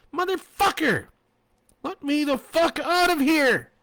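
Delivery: high, loud furious scream; screaming to be let out. A narrator's reading. Trapped woman screaming to be let out. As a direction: yell it with everything she has. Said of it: "she screamed".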